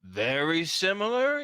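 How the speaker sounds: mock surprise; suspicious orc. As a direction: question it suspiciously. Orc questions.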